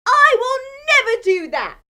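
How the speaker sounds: loud, high, emphatic denial; loud protest. A narrator's reading. Peter protesting loudly to Jesus, emphatic shout. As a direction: Peter insists he never would.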